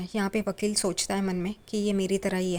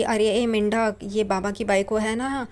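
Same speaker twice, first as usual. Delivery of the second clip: ordinary delivery, nothing marked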